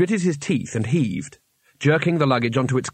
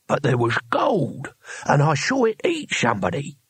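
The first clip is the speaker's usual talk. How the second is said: put-on indignant old Cohen; 5 semitones higher than usual. Cohen disappointed by the luggage, indignant. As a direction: protest in baffled, slurred disbelief.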